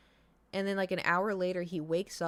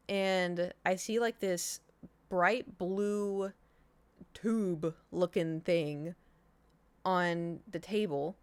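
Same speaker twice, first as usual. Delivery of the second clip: ordinary talk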